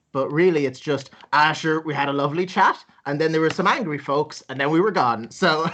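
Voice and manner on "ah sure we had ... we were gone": ordinary talk, nothing marked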